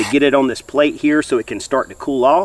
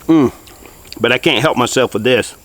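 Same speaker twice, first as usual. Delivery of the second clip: mouth full; 5 dB louder than usual. Real, not acted. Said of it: "I don't like to talk with my mouth full".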